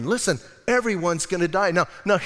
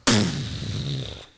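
low scoffing puff of air; dismissive pfft; 11 semitones lower than usual. Retold or imitated. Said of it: "And I'm going".

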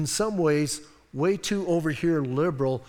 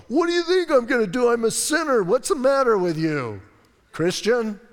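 loud, high mocking sinner voice; talking back like a sinner caught out; defiant sinner; 5 semitones higher, 5 dB louder than usual. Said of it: "I always want a sinner to go".

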